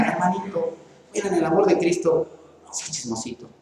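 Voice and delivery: soft, confidential word to a brother; gentle admonishing. Acted. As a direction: correct him quietly and kindly, as an aside. Gentle private correction of a brother, acted. (reprensión suave y discreta)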